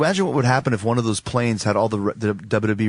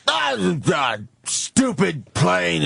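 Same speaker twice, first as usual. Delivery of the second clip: put-on slow, high drunken holler; belligerent drunk; 4 semitones higher, much slower than usual. Drunk passenger complaining about the plane, belligerent slurred yell. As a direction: drag the words out like a loaded complainer. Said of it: "So the guy keeps going".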